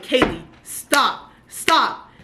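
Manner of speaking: slow, loud, drawn-out plea to stop; pleading with a character. Retold or imitated. Reader pleading with the heroine Kaylee, slow and loud. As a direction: beg the book heroine to stop, slowly. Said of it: "I'm like".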